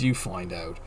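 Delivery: coy hush. Real, not acted. Commentator teasing listeners to tune in later, coy hush. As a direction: drop right down to a secretive low voice. Host coyly withholds his pick.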